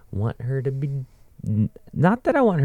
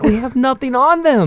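much higher, flustered voice; panicked British; 5 dB louder than usual. Retold or imitated. The UK freaking out over American insults, high panicked voice. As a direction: squeal it like a rattled Brit. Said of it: "It's like".

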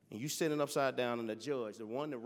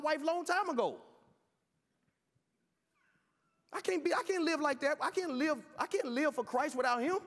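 loud, high fervent preaching; preaching at full force; 8 semitones higher, 6 dB louder than usual. Real, not acted. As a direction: preach it hard to the crowd.

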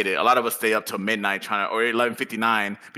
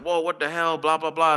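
higher-pitched imitation of people freaking out; mock freaking out; 6 semitones higher than usual. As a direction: lightly act out a crowd's alarm. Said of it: "a lot of people were freaking out".

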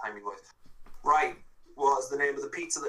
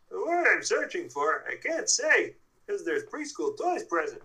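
lightly voiced toy pig, a touch higher; a hint of Ham the pig, slightly raised; Ham's voice; 4 semitones higher than usual. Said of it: "I will say that quote in Ham's voice".

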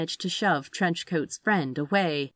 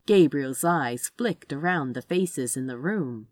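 ordinary delivery

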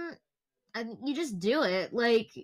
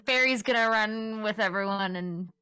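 usual speaking voice

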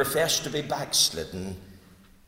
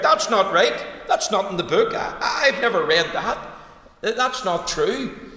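loud, high heckling cry; retold shout; 5 semitones higher, 6 dB louder than usual. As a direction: shout it out from the crowd. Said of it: "shouted out".

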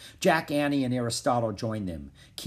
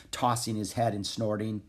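plain reading voice

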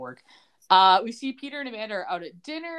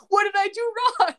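high, louder comic protest; mock exasperated; 9 semitones higher, 4 dB louder than usual. Recapper voicing Michael left standing after a slammed door, mock exasperated. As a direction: plead innocence in hammed-up exasperation. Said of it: "exasperated faces".

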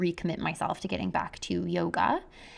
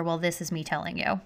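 usual speaking voice

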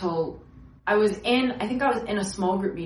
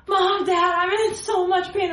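high, sing-song whimper to the parents; mock whining to mom and dad; whiny plea; 9 semitones higher, 3 dB louder than usual. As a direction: play the hurting kid begging for help. Child in pain calling parents.

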